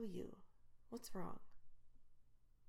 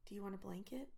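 normal talking voice

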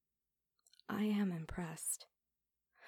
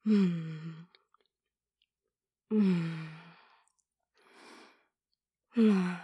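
muffled moan; 10 dB louder, much slower than usual. Acted. Moaning during oral sex scene.

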